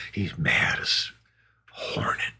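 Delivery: breathy whispered aside; hushed aside. Real, not acted. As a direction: drop to a secret whisper. Aside about the angry friend.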